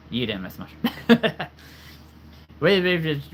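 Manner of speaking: ordinary delivery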